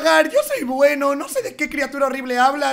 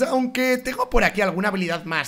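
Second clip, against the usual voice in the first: ordinary talk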